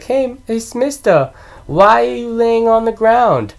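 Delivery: high, curious child imitation; put-on high child's questioning; little kid voice. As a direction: ask it like an innocent little kid. Voices movie kid questioning homeless man.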